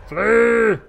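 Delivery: very loud, high, drawn-out shout; full-force high warrior yell; bigger battle cry. Pretend. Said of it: "battle cry".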